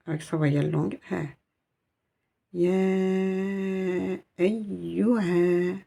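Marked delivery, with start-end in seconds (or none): 2.5-5.8 drawn-out chanted Arabic; slow Quranic recitation. Shown as a demonstration. Teacher to students, chanting the verse slowly. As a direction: recite it slowly, every sound held. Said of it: "reciting Quranic Arabic".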